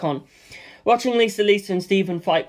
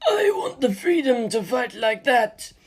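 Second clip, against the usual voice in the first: Stephen's voice; 6 semitones higher, slower than usual. Acted. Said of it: "Stephen said".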